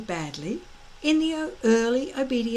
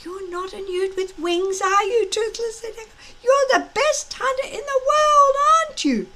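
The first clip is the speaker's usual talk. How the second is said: coaxing encouragement; 11 semitones higher, 4 dB louder than usual. A narrator's reading. Hiccup coaxing his dragon.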